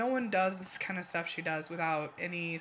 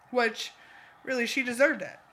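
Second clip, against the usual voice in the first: ordinary talk, nothing marked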